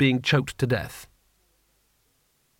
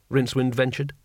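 plain reading voice

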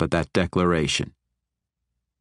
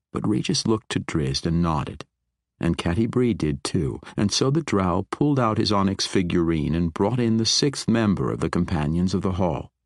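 usual speaking voice

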